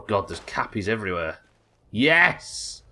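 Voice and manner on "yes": high, excited burst of yes; real excitement. Real, not acted. Streamer reacts to trying on new glasses, genuinely excited. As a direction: burst out with sudden delight. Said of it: "sorry, I got excited".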